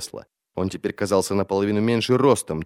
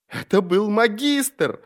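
very high, drawn-out cry; frantic accusation; 11 semitones higher, slower than usual. A narrator's reading. Prisoner accusing the magister in court, frantic. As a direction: wail the accusation slowly, pitched up.

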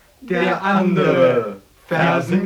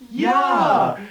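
ordinary talk, nothing marked

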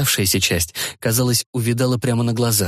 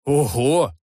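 loud exclamation; 3 semitones higher than usual. Acted. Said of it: "Возглас получился чересчур сильным".

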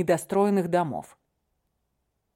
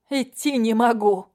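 louder, higher, pleading; desperate, in pain; 4 semitones higher, 4 dB louder than usual. Acted. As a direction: plead loudly through the pain.

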